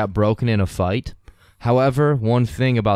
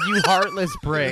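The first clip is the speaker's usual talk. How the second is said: laughing insult; 9 semitones higher than usual. Real, not acted. Mock scolding friend.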